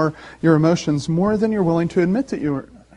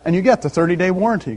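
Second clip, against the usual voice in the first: ordinary talk, nothing marked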